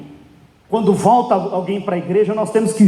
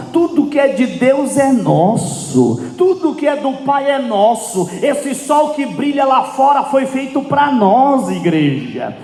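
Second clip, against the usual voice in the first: fervent preaching; 8 semitones higher than usual. Real, not acted. Preacher urging the church to think like sons, fervent preaching. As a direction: preach it in long, driving, ringing phrases.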